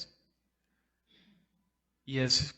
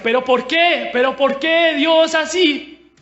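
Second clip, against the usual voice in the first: loud, high-pitched cry of complaint; reenacted anguished yell, words stretched out; angry, hurt protest; 11 semitones higher, 9 dB louder, slower than usual. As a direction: shout at God, indignant and wounded.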